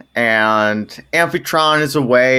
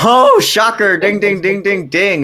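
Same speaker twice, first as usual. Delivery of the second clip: high sarcastic surprise into bell-like dings; mock shock; 6 semitones higher than usual. Co-host greeting another Zeus affair, in mock surprise. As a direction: feign shock, then chime like a winner's bell.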